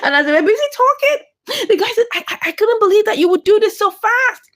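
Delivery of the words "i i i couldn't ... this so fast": high-pitched stammering incredulity; mock disbelief. Storyteller voices the guy who thought her decent, stammering in mock disbelief. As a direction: voice a stunned, stammering man, pitched up.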